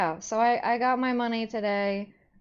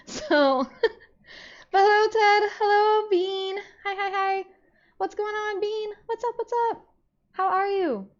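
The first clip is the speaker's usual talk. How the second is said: much higher, louder sing-song hellos; chirpy greeting voice; 13 semitones higher, 6 dB louder than usual. Real, not acted. Streamer greeting viewers Ted and Bean, high chirpy hello voice. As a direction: greet them like excited friends, way up high.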